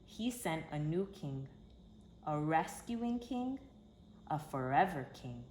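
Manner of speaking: ordinary delivery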